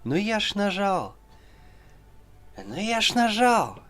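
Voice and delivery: high, rising-then-falling whiny Russian protest; Russian whine. Retold or imitated. Friend insisting in Russian he pressed it, high whiny protest. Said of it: "in Russian it's like".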